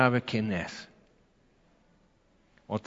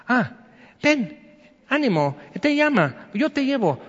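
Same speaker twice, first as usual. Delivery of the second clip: very high, put-on helpful voice; mock sweet coaxing, pitched way up; fake encouragement; 10 semitones higher than usual. Hypocrites suddenly offering to lead the beggar, fake encouragement.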